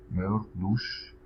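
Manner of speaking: careful slow demo of ما يرقدوش; ما يرقدوش drawn out for learners; slow model. Shown as a demonstration. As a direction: say ما يرقدوش slowly and clearly. Teacher models example slowly.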